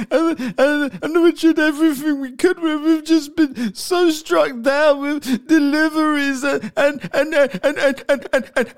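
high, hammed-up blubbering apology; mock crying. Mocking a singer apologising for cancelled shows, fake bawling. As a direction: imitate a stammering, wailing sob, badly faked. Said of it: "sitting there crying on the fucking social media".

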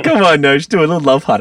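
loud, high coaxing call. Real, not acted. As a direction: cheer him on loudly. Calling Nosh to do a trick.

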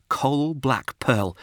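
ordinary delivery, nothing marked

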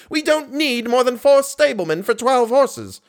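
high-pitched exasperation; roared. A narrator's reading. Manager insists on cutting stablemen, roaring on. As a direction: keep up the indignant outburst. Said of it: "roared Richard".

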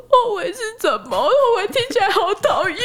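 put-on high kid voice, laughing a little; puzzled child. Child asks mom about regret, high laughing kid voice. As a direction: ask it like a confused three-year-old.

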